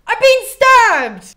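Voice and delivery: high, loud, slow hammed-up wail; overacted death cry, stretched out; mock dying cry. Agamemnon crying out from inside the palace, hammed-up loud wail. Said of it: "he goes".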